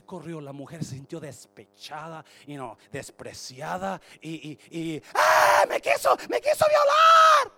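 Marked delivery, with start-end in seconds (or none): 5.1-7.5 shrieking very high and loud; false accusation scream. Acted. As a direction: scream the lie to get him caught. Esposa acusa falsamente. (la esposa de Potifar chillando la acusación)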